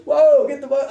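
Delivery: sudden high-pitched startled cry; startled yelp. Retold or imitated. Fighter reliving a beating in the ring, startled high yelp. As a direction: yelp it out as if a punch just landed.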